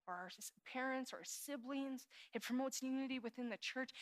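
normal talking voice

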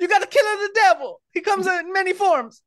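high cartoon Italian accent; Mario. Plumber God giving the Pope orders, high Italian accent. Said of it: "Holy Spirit's got an Italian accent".

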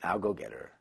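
lightly played king, soft and low; low command. King to his loyalists, low mock command. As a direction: order it darkly under the breath. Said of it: "and he says".

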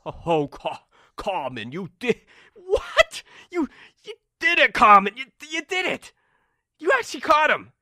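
stammering, high, loud disbelief; jaw-dropped astonishment. Acted. Limbo member sees Carmen's catch, astonished. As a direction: build from stunned stammer to amazed cries. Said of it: "their jaws drop".

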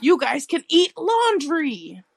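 high, slow goofy voice; silly put-on voice. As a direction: drawl it like a cartoon. Teasing reply in put-on voice.

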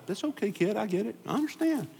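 lightly voiced gentle father figure; kindly God. Pastor voices God comforting an angry child, high and gentle. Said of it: "God said".

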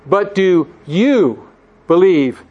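slow, with "you" set apart and punched; stress on you. Shown as a demonstration. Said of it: "with the emphatic "you".

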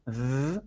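"z" as a soft buzzed th, tongue between teeth; voiced TH sound. Shown as a demonstration. Teacher isolates the first sound, TH buzz. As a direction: hold a quiet voiced th on "z". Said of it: "la lengua entre los dientes".

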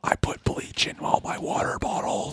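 unvoiced secretive whisper. Whispered joke.

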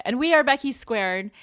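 bright, loud show-intro call; excited announcer. Acted. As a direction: announce the show name with a punch.

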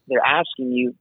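normal talking voice

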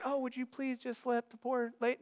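soft, meek mock request; hushed, feeble plea; timid pleading. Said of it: "Jesus isn't saying".

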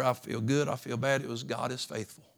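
normal talking voice

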